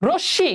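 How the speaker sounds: রশ্মি said "rosh-shi", m silent; doubled sh. Shown as a demonstration. Teacher pointing out the doubling, রশ্মি as "rosh-shi". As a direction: hold the sh in রশ্মি. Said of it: "উচ্চারণটা দুইবার হচ্ছে".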